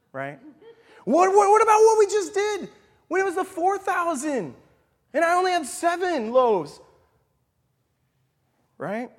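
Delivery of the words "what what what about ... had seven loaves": high, loud disbelieving questions; incredulous Jesus. Retold or imitated. Jesus challenging disciples, retold scripture.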